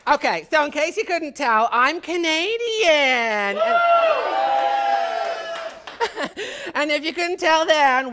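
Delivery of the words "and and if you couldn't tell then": normal talking voice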